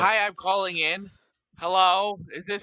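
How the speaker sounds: high, loud put-on caller voice; Pittsburgh caller. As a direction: pretend phone-in listener, pitched well up. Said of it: "caller from Pittsburgh".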